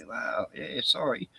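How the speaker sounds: a hint of an embarrassed critic owning up; sheepish apology. People who called him a nutcase, now apologising sheepishly. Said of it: "I've had people come up to me".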